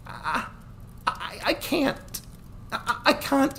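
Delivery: high, halting stammer, slow; words catch as courage fails; a frightened girl who can't go through with it; faltering. Acted. Lisha breaking down, stammering.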